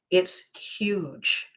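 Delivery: normal talking voice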